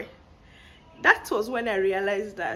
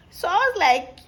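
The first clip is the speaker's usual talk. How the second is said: excited high exclaim; 8 semitones higher, 6 dB louder than usual. Real, not acted.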